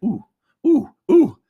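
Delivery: high, short yelps of ooh; acted squeaky oohs, as if dipping a toe in cold water; toe-testing oohs. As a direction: yelp ooh in little high bursts. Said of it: "putting his toe in and be like".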